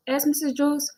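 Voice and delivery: ordinary delivery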